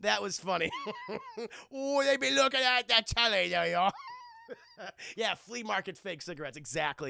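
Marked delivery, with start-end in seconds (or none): 0.7-1.7 squealing high laugh. Real, not acted. Laughing at his own teacher bit, high squealing laugh. 1.7-3.9 high, put-on Cockney relish; Cockney teacher. Teacher sneaking a smoke, relishing mock Cockney. 4.0-4.5 squealing high laugh. Real, not acted. Laughing at his own teacher bit, high squealing laugh.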